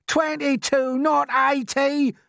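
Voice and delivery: high, loud shriek, slow on the second part. Acted. Earthworm correcting the lying centipede, shrieking. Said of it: "shrieked the earthworm".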